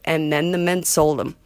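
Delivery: ordinary delivery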